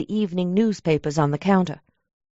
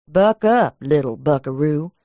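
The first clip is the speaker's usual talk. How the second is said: a light, dry cowboy drawl; lightly imitating John Wayne's gravelly drawl; 4 semitones lower than usual. Inner voice giving counsel, a hint of John Wayne drawl. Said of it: "it said in a dry, gravelly drawl".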